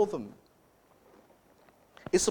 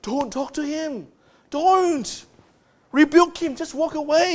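higher, pleading warning; urgent inner plea; 7 semitones higher than usual. Retold or imitated. Preacher voicing the congregation's silent warning to Eve, high and urgent. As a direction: urge her to walk away, pitched up. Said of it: "you'll probably be screaming inside to Eve".

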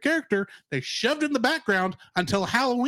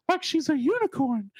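falsetto unicorn voice; 7 semitones higher than usual. Retold or imitated. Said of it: "talking in falsetto voice".

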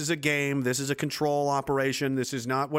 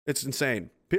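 ordinary talk, nothing marked

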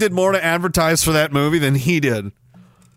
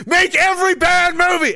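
mock government shout; 10 semitones higher than usual.

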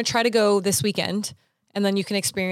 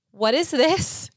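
alarmed question; 6 semitones higher, 3 dB louder, much slower than usual. Retold or imitated. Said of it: "I said out loud".

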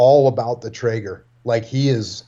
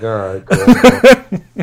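laughing, stretched out; 5 semitones higher, 8 dB louder, much slower than usual. Retold or imitated. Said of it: "I'm like".

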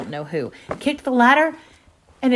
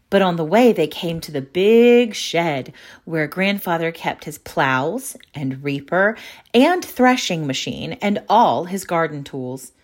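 usual speaking voice

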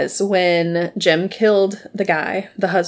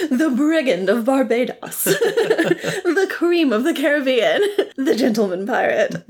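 mock announcer; 4 semitones higher than usual. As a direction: present a pirate with laughing pomp. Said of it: "has to announce Steed when he comes in".

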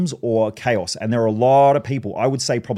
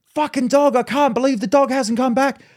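high, furious dog-owner impression; imitated yelling; 13 semitones higher, 4 dB louder than usual. Owner to dog that won't return, imitated yelling. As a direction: rant and yell at the dog in a high mock voice. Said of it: "And she's yelling".

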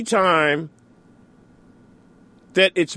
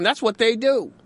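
ordinary talk

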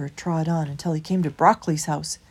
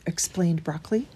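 usual speaking voice

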